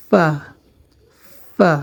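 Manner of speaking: ফা opened with a held f; ফা as "fffa"; lip-to-teeth F. Shown as a demonstration. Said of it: "নিচের ঠোঁটের প্যাটের সাথে লাগবে".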